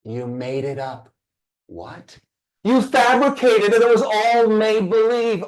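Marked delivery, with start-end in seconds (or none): 2.6-5.4 sudden loud high burst; emphatic shout. Real, not acted. Speaker to audience, shouting the reveal. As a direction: blast it out to jolt them.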